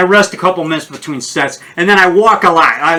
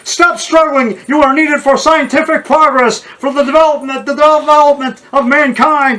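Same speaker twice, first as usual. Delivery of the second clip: mad scientist; 9 semitones higher than usual. Acted. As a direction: lecture the victim like a mad scientist. Mad scientist acting with victim.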